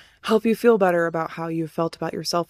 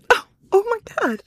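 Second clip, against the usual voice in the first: high cry of realization; shocked; 11 semitones higher, 3 dB louder than usual. Retold or imitated. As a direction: gasp it out in shock. Retold realization.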